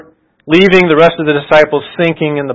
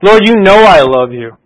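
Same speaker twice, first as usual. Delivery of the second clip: higher, mildly exasperated retelling; frustrated protest; 4 semitones higher, 4 dB louder than usual. Retold or imitated. Peter answering the Lord's repeated question, mildly frustrated protest. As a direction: insist with a touch of frustration. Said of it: "so he's already frustrated".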